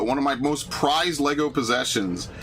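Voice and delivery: normal talking voice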